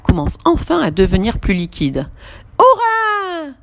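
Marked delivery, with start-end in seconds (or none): 2.6-3.5 high, ringing hurrah; joyful cheer. Acted. Julie cheering as the batter turns liquid. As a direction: cheer at the batter finally working. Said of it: "s'écrie Julie".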